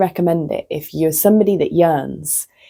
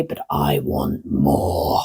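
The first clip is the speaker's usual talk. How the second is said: much lower, slow greedy growl; greedy gremlin voice; 5 dB quieter, much slower than usual. Retold or imitated. Wanting more, voiced low.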